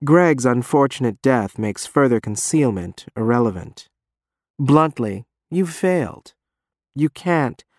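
usual speaking voice

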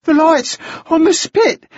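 put-on high breathless boy; winded, forcing words out between gasps; breath in gasps. A narrator's reading. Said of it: "his breath was coming in gasps".

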